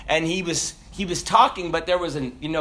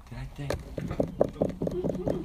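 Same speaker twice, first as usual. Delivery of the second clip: muttered aside; 3 semitones lower, 9 dB quieter, much slower than usual. Real, not acted. Mumbled self-question aside.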